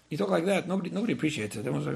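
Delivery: ordinary talk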